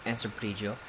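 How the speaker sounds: ordinary delivery, nothing marked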